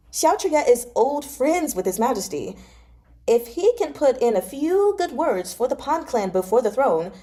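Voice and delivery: lightly voiced pushy friend; eager young noble. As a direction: talk him into coming along. Fei Shi coaxing.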